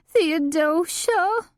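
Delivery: high, slow helpless pleading call; pleading bleat. A narrator's reading. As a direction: beg helplessly, stretching the name. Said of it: "cried in a pleading, helpless bleat".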